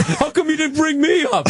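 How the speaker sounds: high-pitched mock complaint; squeaky mock indignation; slighted actor. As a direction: imitate a whining, left-out co-star. Voices a slighted fellow actor.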